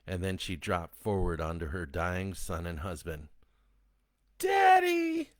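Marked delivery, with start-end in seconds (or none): 4.4-5.3 put-on high, loud shrieking child; girl's scream. A narrator's reading. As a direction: scream for your father. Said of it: "the girl screamed".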